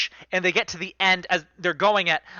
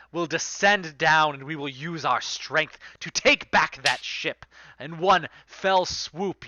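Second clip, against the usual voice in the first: usual speaking voice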